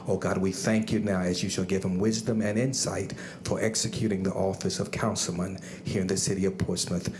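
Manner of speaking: ordinary talk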